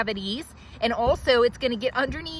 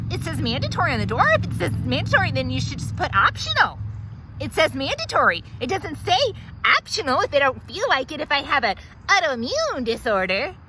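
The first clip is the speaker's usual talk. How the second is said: fast rant; 5 semitones higher than usual. Acted. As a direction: rattle off the grievance. Ranting at the office manager.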